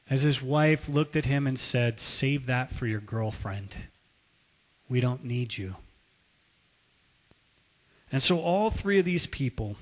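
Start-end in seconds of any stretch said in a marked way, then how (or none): 2.0-5.7 low, soft dismissal; cold rejection. Retold or imitated. Preacher as wife refusing husband's apology, quietly cold. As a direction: turn him away quietly and coldly.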